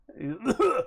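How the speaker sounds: a hammed-up man's cough mid-howl; hammy cough. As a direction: cough it out like a clearing throat. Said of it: "turns into a man's cough".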